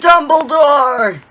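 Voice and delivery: slow, high, loud call of Dumbledore; drawn-out shout. Real, not acted. Friend loudly guessing the chosen one, a drawn-out shout. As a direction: yell the guess out.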